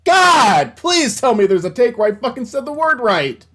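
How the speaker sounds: loud high desperate plea; pleading rant. Real, not acted. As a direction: beg the recording for a good take. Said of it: "infuriates me".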